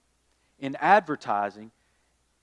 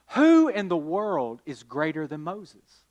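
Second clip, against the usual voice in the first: ordinary delivery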